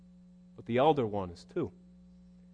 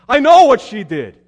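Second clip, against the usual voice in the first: loud, high catty jab; mock gossip; 11 semitones higher, 16 dB louder than usual. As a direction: spit out the old grudge. Gossip mocked.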